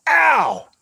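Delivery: loud, high holler of a name; imitating a woman hollering at someone; acted call-out. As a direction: yell it across a crowded room. Retold guru calling out his name.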